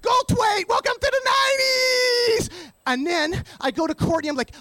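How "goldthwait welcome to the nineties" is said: high, strained cry; over-the-top screechy welcome; hammed-up announcement. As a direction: belt it out and stretch the last word. Bursts out as the comedian.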